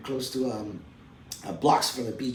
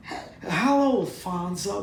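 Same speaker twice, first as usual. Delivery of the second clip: a light, high greeting; lightly voiced showrunner; imitating Barry; 7 semitones higher, slower than usual. Showrunner greeting him on the phone, lightly imitated. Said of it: "'cause he talks like that".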